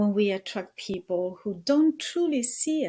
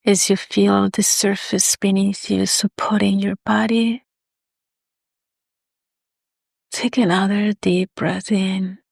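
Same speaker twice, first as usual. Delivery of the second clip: guided meditation; 11 dB louder than usual. Acted.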